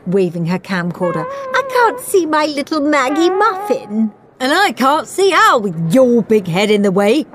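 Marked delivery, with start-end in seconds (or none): 1.5-4.1 high-pitched, complaining mum; demanding mother. A narrator's reading. Mother blocked from filming her daughter, demanding. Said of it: "demanded Margaret's mother". 4.4-7.2 loud, higher, irritable retort; snapping. A narrator's reading. Al's dad to Margaret's mother, snapping. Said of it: "snapped aerobic Al's dad".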